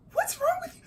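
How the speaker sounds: very high retold squeal; incredulous demand. Wife to underreacting husband, incredulous. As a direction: squeak it in disbelief.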